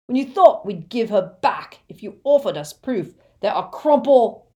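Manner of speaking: loud, high-pitched raging tirade; rough bully in a fury; angry roar. A narrator's reading. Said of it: "roared the Death Eater".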